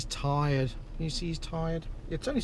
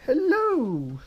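sing-song hello; 9 semitones higher, 5 dB louder than usual. Real, not acted. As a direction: call it up high like a cheerful chime. Greeting the baby again.

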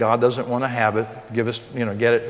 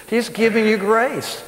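Texas accent, higher and slow, grace stretched out; Texas drawl; 10 semitones higher, slower than usual. Shown as a demonstration. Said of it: "you can say it in a Texas accent, and you gotta spread it out some".

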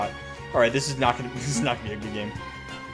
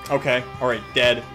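ordinary delivery

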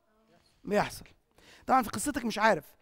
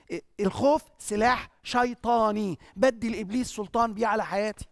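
ordinary delivery

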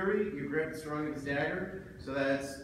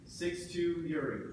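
ordinary delivery

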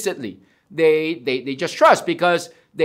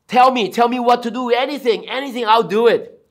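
raised, hurried acted begging; eager pleading; 4 semitones higher than usual. Desperate man asks God what to do, acted eager pleading. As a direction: plead to be told, urgently. Jack begs God for instructions.

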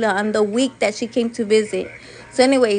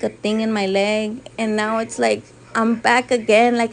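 normal talking voice

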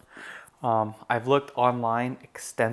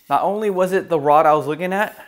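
bursting out faster and louder, pitch jumping up; excited; 6 semitones higher, 8 dB louder, faster than usual. Real, not acted. Telling viewers about the lucky find at the shop, excitedly. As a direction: blurt it out like you can't believe your luck.

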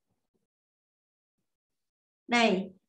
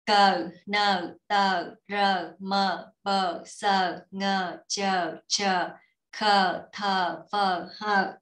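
letters rattled off with short gaps; quick recitation; 3 semitones lower, much slower than usual. Shown as a demonstration. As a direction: run briskly through the letter list. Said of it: "Đọc nhanh lần nha".

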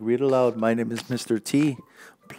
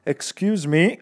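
cricket voice; 6 semitones higher than usual. Acted. Cricket interrupts Gerald.

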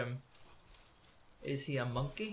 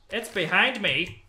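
panicked; 6 semitones higher, 8 dB louder than usual. Real, not acted. Panic, creature behind.

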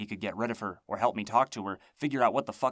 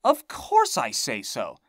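agitated; 3 semitones higher, 8 dB louder, slower than usual. A narrator's reading. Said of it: "getting a little agitated now".

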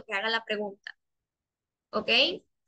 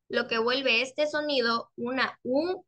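ordinary talk

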